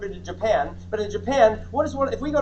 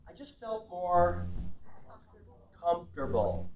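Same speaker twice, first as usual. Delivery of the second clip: slow, softer, with a long pause; hesitant reflection; 4 semitones lower, 7 dB quieter, slower than usual. Acted. Client telling spouse about a feeling, slow and reflective. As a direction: feel for the word, then let it settle low. Acts couple deciding after viewing.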